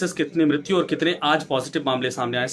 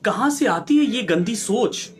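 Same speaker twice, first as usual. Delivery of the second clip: drawn-out, higher-pitched outraged question; indignant; 6 semitones higher, slower than usual. Real, not acted. Anchor condemns attacks on nurses and police, indignant. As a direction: demand it slowly, with real indignation.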